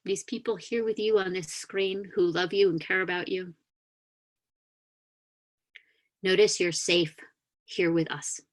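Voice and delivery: calm meditation guide inviting the congregation to notice; guided grounding meditation. Acted. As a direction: gently lead them back into their bodies.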